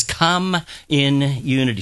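COME, IN, UNITY spaced out slowly; slow, separated words. Shown as a demonstration. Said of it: "Now say it a little faster".

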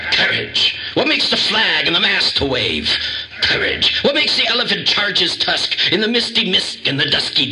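hammy lion speech, rising to a high finish; Cowardly Lion. Retold or imitated. Reciting the lion's big courage speech, growly and building. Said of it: "Then he goes on".

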